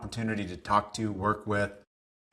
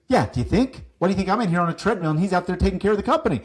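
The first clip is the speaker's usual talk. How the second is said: fast, emphatic mock scolding of the mentee; mentor's retort; 8 semitones higher, 5 dB louder, much faster than usual. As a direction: fire back at the naive kid, louder. Mentor scolding him in retold scene.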